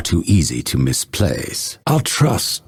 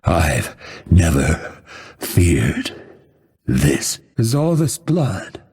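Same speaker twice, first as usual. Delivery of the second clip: halting last words, broken by long pauses; dying words. Acted. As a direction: struggle to get each phrase out as life fades.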